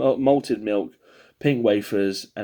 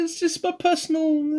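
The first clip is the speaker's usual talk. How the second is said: squeaky high voice. Pretend. Self-conscious disclaimer, pitch jumps up.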